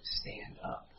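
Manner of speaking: whispered command from an imitated dad; very soft, whispered parental threat; stern hushed order. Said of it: "He goes".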